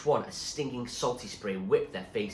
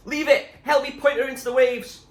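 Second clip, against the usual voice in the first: urgent shout; 13 dB louder than usual. A narrator's reading. Zoe commanding in storm.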